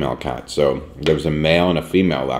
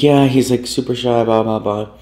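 imitating the owner; 8 semitones higher, 4 dB louder than usual. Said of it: "And she's like".

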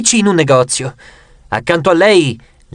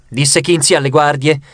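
ordinary delivery, nothing marked

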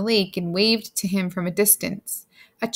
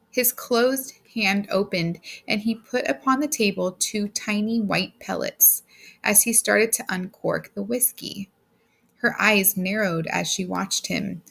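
usual speaking voice

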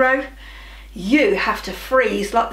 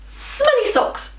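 silly high; 12 semitones higher than usual. Acted. Silly fake-out in countdown.

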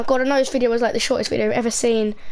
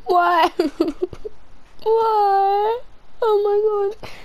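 high, tearful laughing plea; crying with laughter; 9 semitones higher, much slower than usual. Real, not acted. Player overwhelmed by a horror game, tearful laughing. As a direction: squeak it out through helpless laughter. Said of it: "I'm actually crying".

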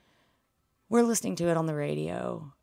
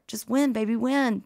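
loud, raised imitation of Al Davis; Al Davis catchphrase; 7 semitones higher, 5 dB louder than usual. Quoting Al Davis, loud and high. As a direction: belt it like the Raiders owner. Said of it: "Thank you, Al Davis".

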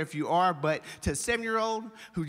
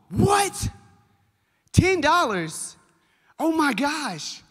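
very high, slow, drawn-out disbelief; shocked wife; 10 semitones higher, 5 dB louder, slower than usual. Retold or imitated. Wife on the phone reacting to a ten-dollar bank fee, very high and aghast. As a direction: gasp it out like the fee is outrageous.

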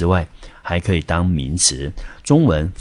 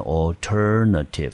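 alternative stressed on TER, the second syllable; 第二音节重音; slower than usual. Shown as a demonstration. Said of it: "这个字的重音我们念成". (alternative重音念在第二音节ter)

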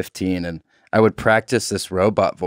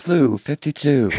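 robot voice; 3 semitones higher, slower than usual. Shown as a demonstration. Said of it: "calling the plays like that".

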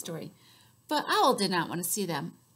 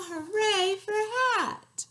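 cheer; 10 semitones higher, 6 dB louder than usual. Acted. Cheering catchphrase.

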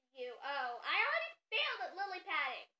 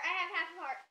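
ordinary talk, nothing marked

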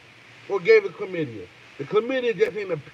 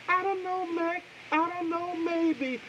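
much higher, softer crooned bit; playful falsetto; 10 semitones higher, 9 dB quieter, slower than usual. Pretend. Singing to the chat in a soft falsetto. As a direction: float it up high and soft, teasing.

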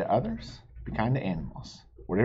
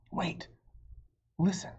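hushed; 7 semitones higher, 7 dB quieter than usual. Acted. Hushed listening in story.

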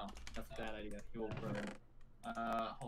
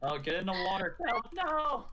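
panicked shout; 8 semitones higher, 9 dB louder than usual. Real, not acted. Friend on fire in game.

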